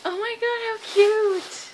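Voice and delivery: squealing over cute treats; gushing. Real, not acted. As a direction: coo at something adorable.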